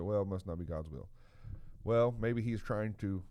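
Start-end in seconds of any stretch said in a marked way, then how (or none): 0.0-1.1 low, resigned half-sigh of surrender; giving up. Retold or imitated. Believer quitting on prayer, resigned. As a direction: give in, lower and softer. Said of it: "we just kinda give up and say".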